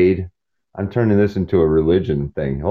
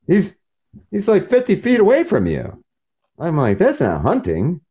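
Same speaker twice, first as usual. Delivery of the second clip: high scornful disbelief; mocking scorn; 11 semitones higher than usual. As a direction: scoff at the easy hunt.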